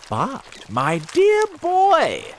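high, loud, drawn-out exclamation; old grasshopper. A narrator's reading. Grasshopper correcting James, as a loud old character. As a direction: exclaim grandly, stretching the words.

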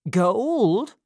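sharp rising "Gold?"; surprised. A narrator's reading. Missus Corney to old Sally, startled and eager. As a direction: caught off guard by the word.

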